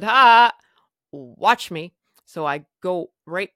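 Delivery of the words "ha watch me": loud burst on Ha, then watch me; defiant retort. Retold or imitated. Patient defying the nurse who forbids a smoke, defiant. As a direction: throw it back as a cocky dare.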